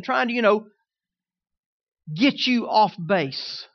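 loud, driving exhortation; preaching. Acted. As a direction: push the church to stand firm. Exhorting the congregation.

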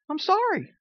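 high-pitched mock meek sorry; small, high, hammed-up apology. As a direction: imitate a hapless husband apologizing. Retold apology to upset wife.

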